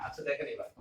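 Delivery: normal talking voice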